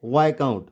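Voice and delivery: viscount as VAI-count; silent S. Shown as a demonstration. Teacher checks students follow, silent S. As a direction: say viscount without the s. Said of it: "viscount नहीं".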